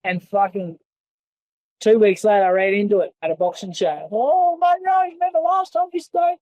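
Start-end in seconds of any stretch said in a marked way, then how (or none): 4.1-6.4 a higher, taunting put-on voice for the other man; mock him in a much higher voice; mocking, whiny imitation. Mocking the manager when they meet.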